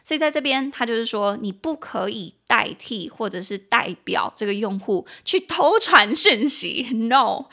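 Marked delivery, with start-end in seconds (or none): 5.2-7.5 louder, slow, firmly drawn out no; emphatic no. Acted. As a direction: wag a finger at the listener: no. Warning against messaging for user.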